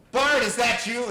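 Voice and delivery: loud, high acted call. Client calling her husband in a dream, loud. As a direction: call out to someone in the shadows.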